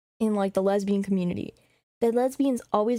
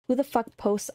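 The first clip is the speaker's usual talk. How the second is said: ordinary talk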